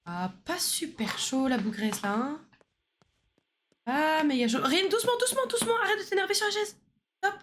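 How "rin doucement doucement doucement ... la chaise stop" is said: soothing. Real, not acted. Calming Rin on the chair.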